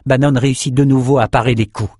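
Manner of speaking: ordinary delivery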